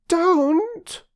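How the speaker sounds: put-on high girl's reproach; girl telling the woman off for taking the baby; reproachful. A narrator's reading. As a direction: scold her gently for waking him. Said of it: "said Phyllis reproachfully".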